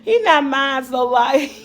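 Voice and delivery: laughing through tears; half laugh, half cry. Real, not acted. Laughing while crying about son.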